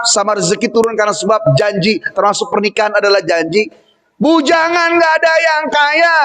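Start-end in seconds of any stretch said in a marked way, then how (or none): 4.2-6.2 high, drawn-out exclamation; calling out. Real, not acted. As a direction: call it out high and slow. Punchline called out to audience.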